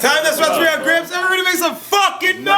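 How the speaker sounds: high, fast announcer shout to the crowd; host hype shout. Real, not acted. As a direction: fire up the crowd for the next rapper. Host announcing the next rapper.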